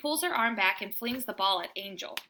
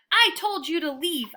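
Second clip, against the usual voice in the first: higher, louder scolding; angry bark; 6 semitones higher, 4 dB louder than usual. A narrator's reading. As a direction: bark at the truckman. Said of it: "I bark".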